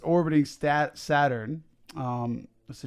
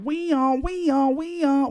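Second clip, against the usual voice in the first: excited; 12 semitones higher, 3 dB louder, slower than usual. Real, not acted. Playful insistence, high voice.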